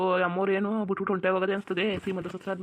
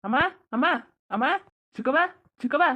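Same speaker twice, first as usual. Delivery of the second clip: calling out; 5 semitones higher, 3 dB louder than usual. Acted. Husband calling mother for help.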